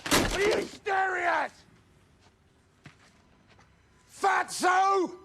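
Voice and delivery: long, shouted jeer; angry yelling. Acted. Man lashing out after the row, aggressive yelling. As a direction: bellow it across the lot.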